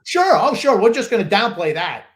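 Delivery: loud, high, sarcastic "oh sure". Real, not acted. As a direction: throw it back sarcastically. Mocking co-host's dismissive answer.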